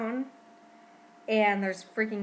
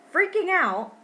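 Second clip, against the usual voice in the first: panicked; 10 semitones higher, 10 dB louder than usual. Real, not acted. Retelling panic over bleeding.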